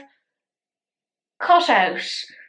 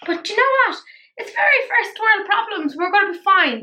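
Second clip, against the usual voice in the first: upbeat; 11 semitones higher, 4 dB louder than usual. Real, not acted. Mock dramatic reassurance.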